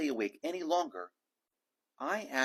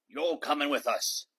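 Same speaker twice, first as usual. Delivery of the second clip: louder pirate captain order; pirate command; 3 semitones higher, 5 dB louder than usual. A narrator's reading. Braid Beard telling Jeremy to come. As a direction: tell him like a captain decides. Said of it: "Braid Beard told me".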